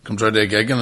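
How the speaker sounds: ordinary talk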